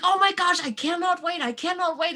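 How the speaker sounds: can't-wait excitement, pitched high; giddy teen excitement. Retold or imitated. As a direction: gush like a kid about to drive. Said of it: "super excited".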